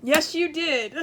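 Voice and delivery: ordinary delivery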